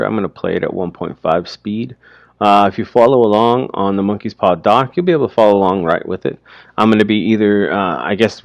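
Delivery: ordinary delivery, nothing marked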